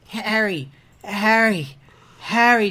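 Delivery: hammed-up groan on a repeated name; mock groaning. A narrator's reading. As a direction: groan it longingly, each call building. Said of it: "George groaned".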